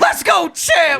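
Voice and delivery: high, loud hype cheer; pumped-up catchphrase yell; hype shout. Acted. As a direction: yell it like a ringside hype man.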